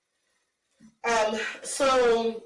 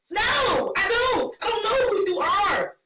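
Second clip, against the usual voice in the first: indignant refusal; 9 semitones higher than usual. Acted. Acting out brushing off a man.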